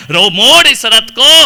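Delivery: loud order to the charioteer; urgent command. Acted. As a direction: call out forcefully to turn back. Buddha का सारथी को आदेश. (सारथी को ज़ोर से आदेश)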